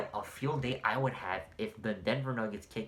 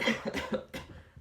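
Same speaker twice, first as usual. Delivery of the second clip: choking cough; harsh choking coughs; coughing fit. Real, not acted. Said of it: "I'm choking here".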